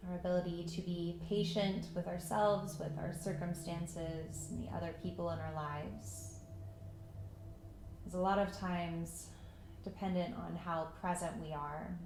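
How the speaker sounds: calm meditation voice with long pauses; unhurried, even guidance into stillness; guided meditation. Acted. Yoga teacher settling students into breath and patience, guided meditation.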